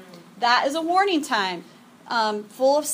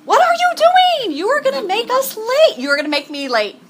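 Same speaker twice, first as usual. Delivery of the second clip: frantic mom yell, much higher and louder, peaking at what are you doing; retold yelling at kids; 7 semitones higher, 7 dB louder than usual. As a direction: hurry the kids into the van, mock-exasperated shout. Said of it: "then I am yelling".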